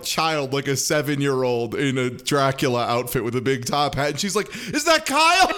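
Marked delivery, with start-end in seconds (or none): 4.7-5.5 high-pitched, hammed-up disbelief; mock shock. Host mimicking Zoe spotting a child in costume, mock shocked. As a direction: squeal it as a clueless mock gasp of recognition.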